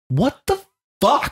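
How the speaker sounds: high, slow stunned what the fuck; stunned disbelief. Retold or imitated. Dad reenacting his shocked muttered reaction, high and slow. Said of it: "I'm like".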